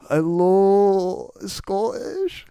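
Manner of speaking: high, drawn-out mock Scottish accent; silly Irish attempt sliding into Scottish; failed Irish accent. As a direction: overdo a bad Scottish accent, pitch climbing. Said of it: "I can't do an Irish accent. Not right".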